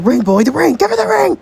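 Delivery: high, loud, insistent urging; excited high-pitched nudge; urgent prompting. Acted. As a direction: push him urgently, pitch climbing. Character urging a proposal.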